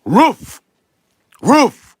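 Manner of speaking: high, loud single barks; dog bark. Acted. Dog answers the man's question, barking. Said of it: "And the dog answers".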